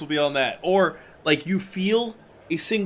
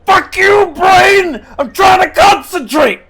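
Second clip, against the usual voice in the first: high, full-voiced cursing at your own brain; furious yell; 12 semitones higher, 10 dB louder, slower than usual. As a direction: explode at your distracting thoughts. Acts out cursing his own brain.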